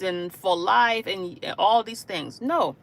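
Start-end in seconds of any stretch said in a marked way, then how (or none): none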